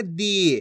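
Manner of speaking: दी drawn out on the vowel; long ee in दी. Shown as a demonstration. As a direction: hold the ई of दी. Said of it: "खींच खींच के पढ़ना है". (दी की ई खींचकर)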